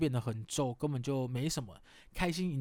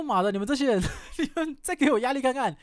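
louder, higher half-laughing mock rage; mock fury; 8 semitones higher, 5 dB louder than usual. Fed up with the coaches' teasing, half-laughing mock rage. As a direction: snap back like you're fed up with them. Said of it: "我想说".

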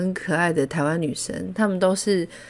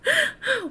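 high shy giggle; a bright embarrassed giggle; laughing; 3 dB quieter than usual. Real, not acted. 害羞地笑. (害羞的高声笑)

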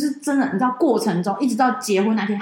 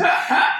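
breathy laugh; 7 semitones higher than usual. Real, not acted. Laughing after slap joke.

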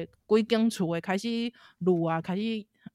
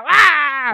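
mock scream; 7 semitones higher, 11 dB louder than usual.

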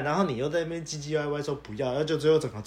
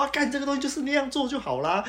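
usual speaking voice

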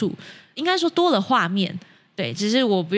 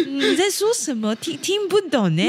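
put-on Japanese accent in Mandarin; 6 semitones higher than usual. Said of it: "所以现在还要带日本腔来".

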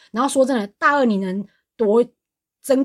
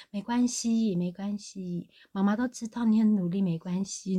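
soft, hushed motherly comfort; much softer mock-soothing mother; gentle reassurance; 8 dB quieter, slower than usual. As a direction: imitate mom going suddenly tender and quiet. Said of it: "突然变成一个好人".

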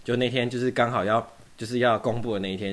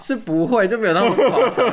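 excited denial; 12 semitones higher, 9 dB louder than usual. Real, not acted. Laughing denial of obsessive fandom.

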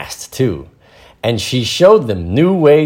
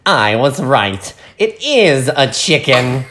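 smug; 4 semitones higher than usual. Acted. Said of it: "that voice".

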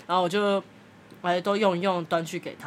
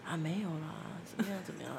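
much softer, shrinking mumble of a cornered husband; timid cowering husband; 12 dB quieter than usual. Retold or imitated. Husband caught out by his wife, meekly and softly denying. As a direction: cower and mumble, barely voiced. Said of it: "先生就有点畏畏缩缩".

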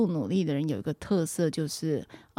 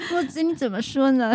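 put-on baby voice; 7 semitones higher than usual. Shown as a demonstration. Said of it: "给我们一起练习一下娃娃音".

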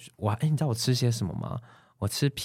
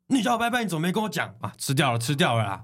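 reenacting an enraged master on request; furious master; 6 semitones higher than usual. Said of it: "多气，你现在模拟一下。".

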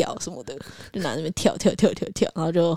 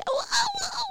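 excited squeal; 7 dB quieter, slower than usual. Retold or imitated. Raising both hands at party.

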